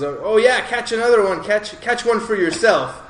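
a hearty mock pirate shout; pirate yelling. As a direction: holler it across the room like a rowdy pirate. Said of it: "one of the pirates yells".

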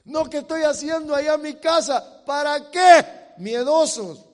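loud, high pulpit challenge; forceful sermon shout; full-force preaching. Real, not acted.

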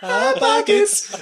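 drawn-out, very high "Hot Pockets" call; jingle mimic. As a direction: mimic people blurting it out, high and stretched. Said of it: "automatically just gonna go".

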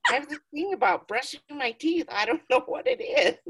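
high, put-on voice for the dream man; hammed-up dream lover with a laugh; mock Blade voice. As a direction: play him silly and high, laughing through it. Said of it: "And he's like".

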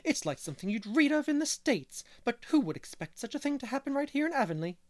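high, loud scandalized gossip; shocked village busybody, voice climbing; indignant. A narrator's reading. Mrs Lynde decrying Mr Harrison, shrill indignation. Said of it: "she said indignantly".

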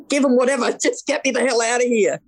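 high, fed-up hypothetical reply; exasperated. Retold or imitated. To the arguing porters about the money, high exasperated. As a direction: wave it all away, just get out.

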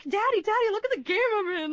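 high-pitched, quick excited squeal to Dad; excited daughter. Retold or imitated. Daughter proudly showing her dad the game, excited. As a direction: call out to Daddy, high and eager. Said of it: "I was like".

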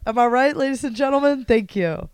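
ordinary talk, nothing marked

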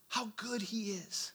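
hushed and slow, full of awe; sincere emphasis. Real, not acted. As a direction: linger on each word. Repeated for emphasis.

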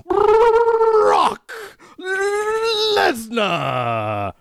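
drawn-out ring announcer call; announcer shout. Acted. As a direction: bellow the name like a ring announcer. Wrestling announcer name call.

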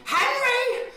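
piercingly high, loud cry; Lorraine Bracco impression. Comic voicing the actress on the list, high yell. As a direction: call out the name at full pitch. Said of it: "Lorraine Bracco".

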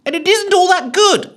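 high, loud ghost losing confidence; unsure but loud. A narrator's reading. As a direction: shout it, then doubt yourself. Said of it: "suddenly sounding not quite so sure".

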